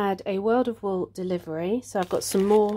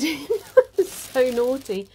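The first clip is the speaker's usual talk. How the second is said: ordinary delivery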